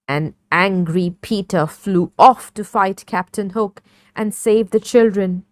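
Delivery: ordinary delivery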